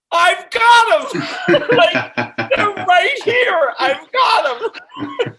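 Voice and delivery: high, slow, shouting with glee; thrilled yell, high and drawn out; excited shout. Retold or imitated. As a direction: shout it out like a win. Overjoyed she has his records.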